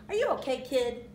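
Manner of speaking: high, loud cartoon sidekick; meerkat. A narrator's reading. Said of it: "asked the mercat".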